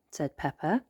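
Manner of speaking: whispered narration. A narrator's reading.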